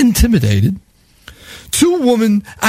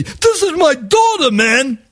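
screaming; 6 semitones higher than usual. Retold or imitated. Panhandler screaming at the narrator. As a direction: yell it in his face. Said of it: "He's screaming at me".

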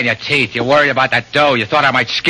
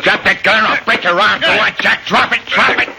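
commanding, rising higher with each order; angry order; 8 semitones higher than usual. Acted. Pete forcing his partner to drop the gun, angry command. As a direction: bark it at him until he lets go.

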